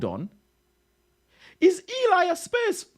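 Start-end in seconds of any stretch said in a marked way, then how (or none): 1.6-2.9 can't believe what he just read; shocked disbelief. Real, not acted. Streamer learns his chat mod supports Spurs, shocked. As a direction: pitch shoots way up in disbelief.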